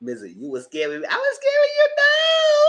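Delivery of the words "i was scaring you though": squeaky high-pitched protest, laughing; high squeal. Real, not acted. Telling Mizzy she was the scary one, high squeal. Mock-offended high reply to friend.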